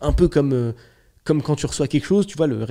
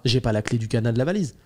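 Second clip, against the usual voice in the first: ordinary talk, nothing marked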